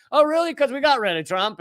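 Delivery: high, sarcastic disbelief. Real, not acted. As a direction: scoff at the idea. Sarcastic rebuttal to critics.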